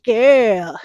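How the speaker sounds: imitating a scolding elder, loud on girl; mock-scold like the neighborhood aunties; a lightly voiced nagging elder telling her off. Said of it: "People gonna be on my head".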